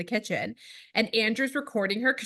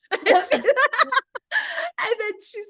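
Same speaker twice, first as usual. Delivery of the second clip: 1 laughing; 14 semitones higher, 4 dB louder, much slower than usual. Real, not acted. Laughing at daughter's story.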